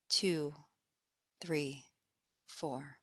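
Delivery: very soft, low count fading away; exhale count. Acted. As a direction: let each number sink as they breathe out. Counting the out-breath.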